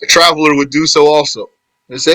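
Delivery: usual speaking voice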